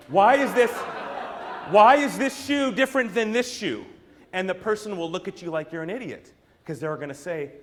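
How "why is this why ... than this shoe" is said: loud, raised, insistent mock question; mock challenge. Customer holding up shoes to the clerk, mock challenge. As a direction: put it to them as a big comic challenge.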